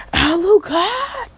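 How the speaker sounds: high, drawn-out shout of a name; acted yell. She calls Alucard as villains attack, an acted yell. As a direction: call out loudly, stretching the name. Acts her calling out to Alucard.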